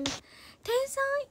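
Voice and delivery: 高い子供っぽい声で「天才！」; 園児になりきって無邪気に叫ぶ; 園児の声で褒める. Pretend. 園児として褒める. (幼い子がはしゃぐように高く)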